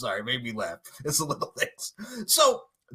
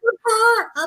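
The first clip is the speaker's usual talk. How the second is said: very high, louder squeal; gushing cheer; 5 dB louder, slower than usual. Real, not acted. Agreeing with co-host about the sister, high gushing cheer. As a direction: echo it in a delighted falsetto.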